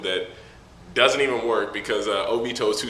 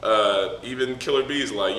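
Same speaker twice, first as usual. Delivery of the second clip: ordinary delivery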